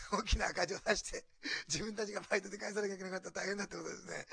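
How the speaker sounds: talking through a laugh; amused retelling. Real, not acted. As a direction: laugh as you recount the near-disaster. Laughs while retelling a money disaster. (笑いまじりに語る)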